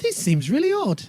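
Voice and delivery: put-on leaning hard into Tracy's accent; Tracy's accent retry. Tracy speaking up on a second try, high emphatic accent. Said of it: "I lost her accent now".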